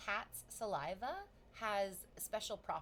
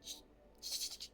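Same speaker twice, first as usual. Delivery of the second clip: shushing herself; 5 dB quieter than usual. Retold or imitated. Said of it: "Don't say this out loud".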